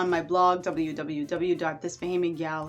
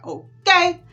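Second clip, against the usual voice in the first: emphatic okay; 12 semitones higher, 10 dB louder than usual. Real, not acted. Emphatic tag after bragging.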